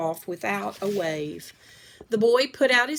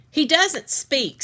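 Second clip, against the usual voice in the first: higher, louder, slow and impatient; 8 semitones higher, 6 dB louder, slower than usual. A narrator's reading. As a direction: brush the question off impatiently. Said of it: "said the woman impatiently".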